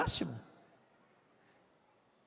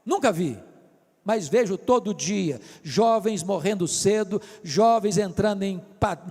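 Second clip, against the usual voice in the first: ordinary talk, nothing marked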